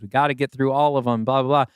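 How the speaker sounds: ordinary delivery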